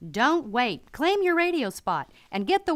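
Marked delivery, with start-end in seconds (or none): none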